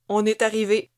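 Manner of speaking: loud gruff driver calling back; driver announcing. Acted. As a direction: announce the arrival to the squad. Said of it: "annonça le conducteur".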